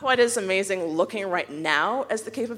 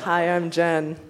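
robot voice; 3 semitones lower, slower than usual. Real, not acted. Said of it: "You said that like a robot".

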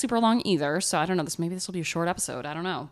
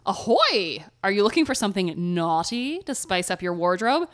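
ad read; 2 semitones higher, 3 dB louder than usual. Acted. Cheery pirate ad read.

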